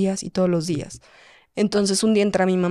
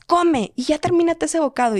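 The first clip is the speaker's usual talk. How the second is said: pitched-up nagging mum voice, mildly played; a light impression of a mother hurrying her child; mum nagging to eat; 8 semitones higher than usual. Imitating her mum urging her to finish the bite.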